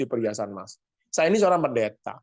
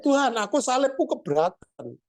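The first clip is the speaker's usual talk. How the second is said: high, whiny complaint about a heavy cross; man pleads with God that his cross is too heavy; complaining man; 10 semitones higher than usual. Acted. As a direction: whine to God about the burden.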